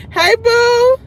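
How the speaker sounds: high, loud sing-song hey boo; excited greeting. Real, not acted. Streamer greeting a viewer, high and loud. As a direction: call out to a friend in chat.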